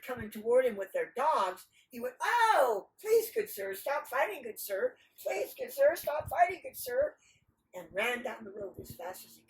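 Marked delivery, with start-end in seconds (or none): 2.2-7.2 high, loud begging; hammed-up alarmed entreaty; frightened pleading. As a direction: plead frantically as dogs close in. Nasrudin begs as dogs approach.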